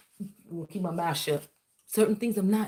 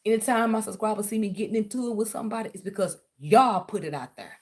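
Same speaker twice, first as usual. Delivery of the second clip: ordinary talk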